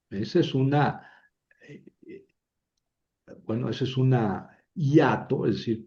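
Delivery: ordinary talk, nothing marked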